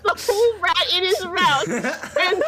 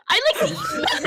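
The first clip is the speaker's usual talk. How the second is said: sudden yell. Acted. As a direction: burst out on "men". Princess repeats line, shouted.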